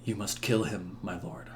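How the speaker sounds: very soft, low urging; hushed whisper. Acted. Igraine whispers to the king in the hush, very soft. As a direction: murmur it into a silent room. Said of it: "whispers to him in the hush".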